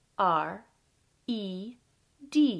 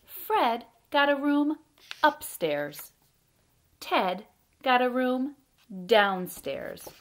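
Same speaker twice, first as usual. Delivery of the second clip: loud, deliberate picture-book narration with pauses; reading to children; 4 semitones higher, 7 dB louder, much slower than usual. A narrator's reading. Storyteller reads a bedtime tale about Fred and Ted, lively read-aloud. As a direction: read it out with big, bouncy emphasis.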